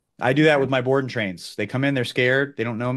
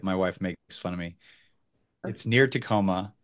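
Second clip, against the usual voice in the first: ordinary delivery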